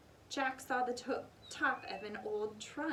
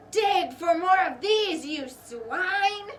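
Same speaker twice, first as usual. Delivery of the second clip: pirate order; 7 semitones higher, 11 dB louder, slower than usual. A narrator's reading. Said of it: "he said".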